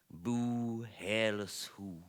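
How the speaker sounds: a pretend sob stretched out slowly; mock crying. Heartbroken lion lying down crying about his mane, in mock crying.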